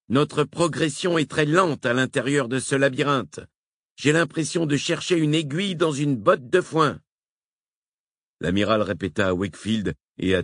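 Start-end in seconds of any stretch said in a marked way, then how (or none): 0.1-7.0 docteur Brown. A narrator's reading. Brown speaks.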